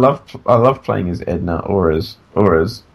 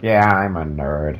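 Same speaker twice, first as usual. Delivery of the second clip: lower, slow, nerdy mumble; 5 semitones lower, 3 dB quieter, slower than usual. Retold or imitated. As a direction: play a shy nerd. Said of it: "an American audience and was like".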